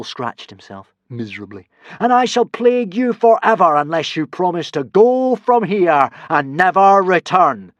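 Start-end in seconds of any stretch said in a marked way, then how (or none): 2.0-7.7 loud, high, solemn god voice; solemn boom. A narrator's reading. Hiccup as a god solemnly booming at the consul. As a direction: pronounce it gravely. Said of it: "boomed Hiccup".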